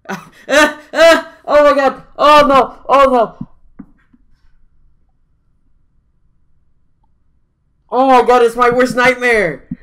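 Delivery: high, loud panicked shrieks, real fright; terrified shrieking. Real, not acted. Reacting to butterflies on screen, panicked screaming. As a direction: scream like your worst nightmare just flew at you.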